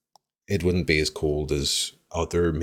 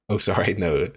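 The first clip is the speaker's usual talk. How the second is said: usual speaking voice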